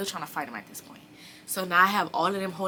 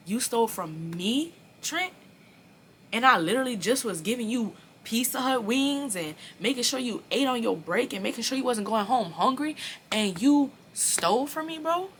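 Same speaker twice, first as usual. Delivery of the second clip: rising high and louder on the name; hurt outrage at a friend; retold hurt accusation; 6 semitones higher, 4 dB louder than usual. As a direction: accuse him, stung and disbelieving.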